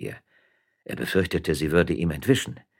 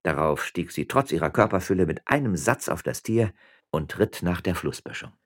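plain reading voice

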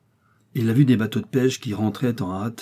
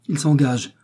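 higher, loud call; 6 semitones higher, 4 dB louder than usual. A narrator's reading. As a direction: announce it urgently. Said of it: "clama le gardien".

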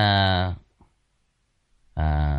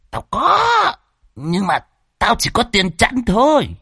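very high, squeaky cartoon voices; squeaky comic bickering; 13 semitones higher than usual. Phọt and old Đồng haggling over change, very high squeaky voices.

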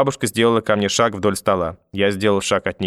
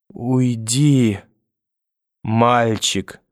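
slow, laboured words with long gaps; speaking with difficulty; much slower than usual. A narrator's reading. Collapsed grandmother sending boy away, barely managing words. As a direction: drag each word out as if fading. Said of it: "с трудом проговорила бабушка".

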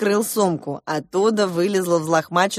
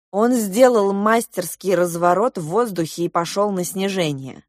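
usual speaking voice

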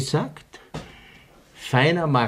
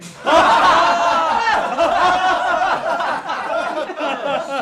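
laughter; 5 dB louder than usual. Acted. Crowd bursts out laughing.

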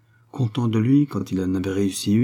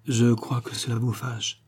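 slow, faltering worry; a touch of timid stammer; 2 semitones higher, slower than usual. A narrator's reading. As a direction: say it slowly and unsurely. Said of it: "Elle balbutia".

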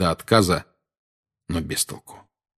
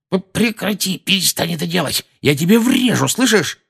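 scared threat; 11 semitones higher than usual. Acted. Dudley terrified, threatening.

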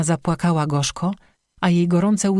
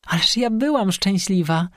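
lightly voiced despairing outcry, higher; desperate cry; 4 semitones higher than usual. A narrator's reading. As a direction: plead it out in mild despair. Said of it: "krzyknęła Dobrosława z rozpaczą". (rozpaczliwy okrzyk, lekko zagrany)

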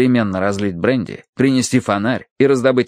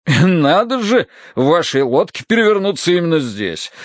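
amazed; 7 semitones higher than usual. A narrator's reading. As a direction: marvel at the coincidence as the colonel. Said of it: "изумлялся полковник". (изумлённо, с растяжкой)

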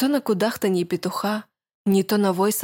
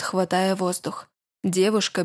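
ordinary delivery, nothing marked

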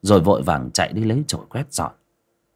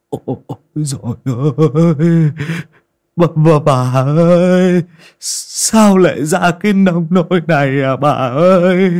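high, loud grief-stricken wail; khóc than thảm thiết; anguished wailing; 7 semitones higher, 6 dB louder than usual. Acted. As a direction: lament over his wife's body. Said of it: "lão không kìm lòng được".

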